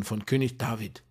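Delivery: ordinary talk, nothing marked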